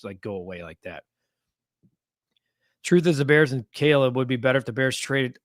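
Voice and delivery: usual speaking voice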